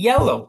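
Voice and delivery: Yellow with a high, loud YEL, first syllable punched; teacher confirming the answer with stress on yel. Shown as a demonstration. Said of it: "Yelnya kan ya? Yang ditekankan ya?".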